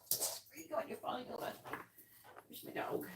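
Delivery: breathy whisper, high and soft to the dog. Real, not acted. Owner whispering playfully to her dog, hushed coo. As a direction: whisper like sharing a secret with the puppy.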